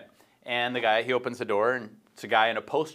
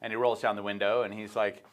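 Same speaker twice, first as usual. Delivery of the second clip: usual speaking voice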